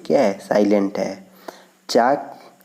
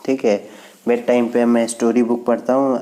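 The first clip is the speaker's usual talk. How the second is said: normal talking voice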